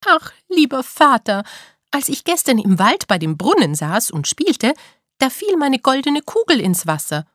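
put-on a young princess whining to her father; complaining princess. Princess explains her promise to the king, high whining voice.

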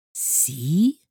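baffled, loud rising question. A narrator's reading. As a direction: ask it in puzzled surprise. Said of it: "fragte das Sams verblüfft". (verblüfft nachfragend)

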